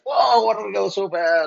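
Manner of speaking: high, hammed-up exclamation; high mock-envious shout; imitated envy. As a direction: mimic envious coworkers wailing with longing. Envious coworkers voiced in story.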